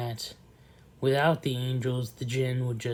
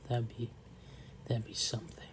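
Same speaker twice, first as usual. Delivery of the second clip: whisper; 10 dB quieter, faster than usual. Real, not acted. Trailing dreamy aside.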